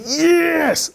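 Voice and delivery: jubilant cry of yes; ecstatic yes. Retold or imitated. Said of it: "I went".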